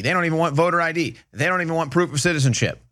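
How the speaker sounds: ordinary delivery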